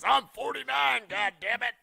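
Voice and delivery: put-on high, loud furious old man; mock angry shout. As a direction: yell like a cranky old man. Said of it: "He's very angry".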